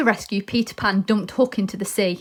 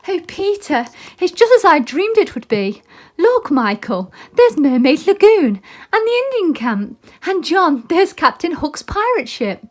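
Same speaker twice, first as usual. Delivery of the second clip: put-on high, louder delighted girl; awestruck girl; 9 semitones higher, 6 dB louder than usual. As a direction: gasp in wonder at the view. Wendy flying over Neverland.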